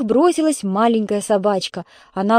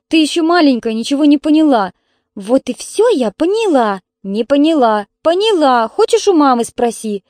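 loud, higher squabbling; childish bickering; 6 semitones higher, 5 dB louder than usual. Acted. Two sisters quarrel over who understood, bickering. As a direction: argue back and forth like sisters.

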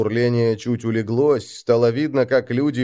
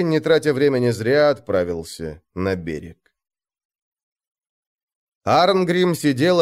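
ordinary delivery, nothing marked

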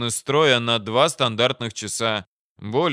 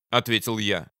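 plain reading voice

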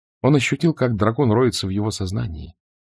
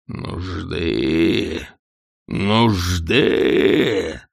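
dragon roar; 3 semitones lower, much slower than usual. A narrator's reading. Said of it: "взревел дракон".

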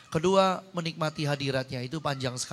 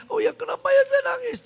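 loud, very high crying voice; re-enacted tearful question; 9 dB louder than usual. Preacher re-enacts asking the Holy Spirit, high tearful wail. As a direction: wail the question as if bawling, acted out. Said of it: "Saya nangisnya sampai gini".

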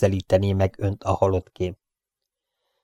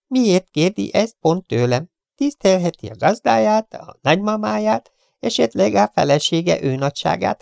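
high, curt, insolent gangster voice; rude Chiefen; 11 semitones higher, 4 dB louder than usual. Acted. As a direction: sneer the intruder off. (magas, pimasz, goromba hang)

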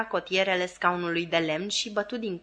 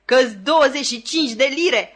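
delighted; 6 semitones higher, 7 dB louder than usual. A narrator's reading. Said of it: "făcu Joe încântat".

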